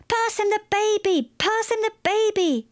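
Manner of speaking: high-pitched, urgent yell; frantic repeated shout. A narrator's reading. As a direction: yell the order desperately, voice pitched right up. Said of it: "Maeve shouted".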